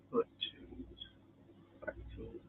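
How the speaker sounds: whispering. Pretend. Repeated, voiceless.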